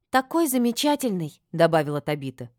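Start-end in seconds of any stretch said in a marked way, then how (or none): 0.1-1.4 high, loud admiring exclamation; rapturous gushing. A narrator's reading. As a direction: chime in with excited admiration. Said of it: "восторженно произнесла Сибилла". (восторженно, высоко и громко)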